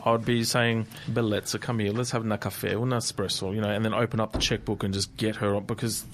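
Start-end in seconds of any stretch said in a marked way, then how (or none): none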